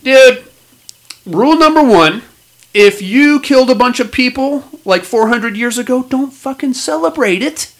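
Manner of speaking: outraged rant. Real, not acted. To co-hosts about celebrating a massacre, fired-up high outrage. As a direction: rant with mounting disbelief.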